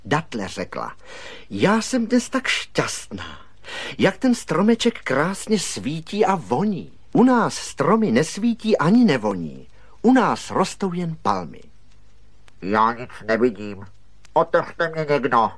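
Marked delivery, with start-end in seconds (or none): 1.5-11.6 high, swooping, elated voice; happy delight. A narrator's reading. Date fruit admires the glowing Christmas tree, happy delight. 12.6-15.5 muffled voice from inside a shell; hollow voice. A narrator's reading. Walnut calls from inside its shell, hollow voice. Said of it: "ozvalo se dutým hlasem".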